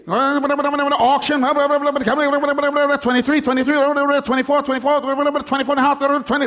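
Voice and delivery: rapid high auctioneer patter. Pretend. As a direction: rattle off the bids like an auctioneer. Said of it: "It's like incantations".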